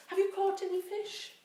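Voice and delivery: calls out loud and high across the water; shouting from the shore. A narrator's reading. Stranger on the shore calling to the fishermen, loud call. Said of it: "the man shouted to them".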